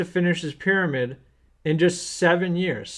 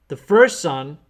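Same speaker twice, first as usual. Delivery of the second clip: usual speaking voice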